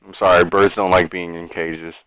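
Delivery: ordinary talk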